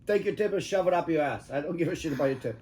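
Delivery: ordinary talk, nothing marked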